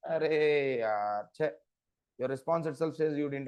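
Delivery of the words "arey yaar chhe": long drawn-out sigh of frustration; exasperated. Real, not acted. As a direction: stretch it out, fed up. Teacher exasperated at students' confusion.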